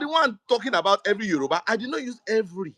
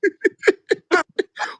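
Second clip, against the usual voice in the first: high, loud burst of laughter; 12 semitones higher, 6 dB louder than usual. Real, not acted. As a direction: burst out laughing over the argument. Laughs at the squabble.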